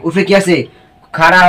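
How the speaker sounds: ordinary talk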